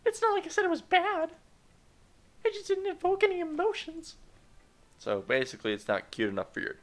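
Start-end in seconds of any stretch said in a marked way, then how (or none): 0.0-4.2 put-on sassy high falsetto girl; snooty anime girl, very high; high girly voice.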